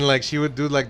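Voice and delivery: ordinary delivery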